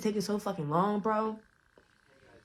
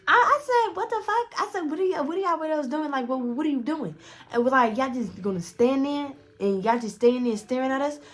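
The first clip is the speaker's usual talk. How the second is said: high-pitched retold confrontation; mock outraged challenge, pitched well up; acted indignation; 7 semitones higher, 4 dB louder than usual. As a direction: act out calling someone out, high and incredulous. Acts out confronting two staring men.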